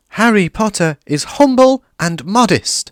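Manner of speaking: very high, loud elf voice; worshipful house elf; high adoring elf; awed elf. A narrator's reading. Dobby praising.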